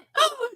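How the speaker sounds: high shocked gasp; sharp gasp. Real, not acted. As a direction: suck in air in shock. Shocked reaction to DNF news.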